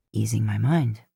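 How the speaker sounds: low, easygoing man's voice; Dean's low voice. Acted. Male character voice, lowered.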